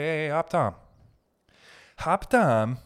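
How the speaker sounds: a hint of put-on Chinese accent; mock Chinese accent. Comedian imitating an optometrist, mock Chinese accent. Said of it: "Chinese guy".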